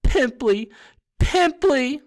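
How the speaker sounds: very high, loud jeering yell; yelled taunt. A narrator's reading. Poltergeist chases the ghost pelting her with peanuts, yelling.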